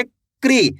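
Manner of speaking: क्री said as one syllable, k running into ri, pitch falling; क्री with k and r joined, firmly let fall; forceful KRI sound. Shown as a demonstration. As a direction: punch out क्री as a single "kree". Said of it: "तेजी के साथ आपको इसका उच्चारण निकालना है".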